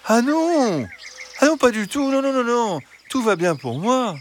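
high, quick fox denial; fox protesting. Acted. Fox saying he is not in danger, high and eager. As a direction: brush off the worry as the fox.

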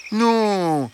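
high, sweet-talking fox voice; reassuring fox. Acted. Fox assuring hens he is not hungry, reassuring. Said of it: "Une voix douce et rassurante".

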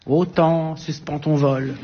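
lent et déclamé, comme un vers de Lamartine; réciter le vers avec emphase; déclamation poétique. Retold or imitated. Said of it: "Ah, Lamartine". (déclamation lente d'un vers de Lamartine)

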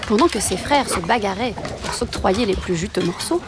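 usual speaking voice

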